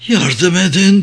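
plain reading voice

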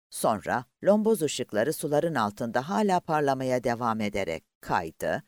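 ordinary delivery, nothing marked

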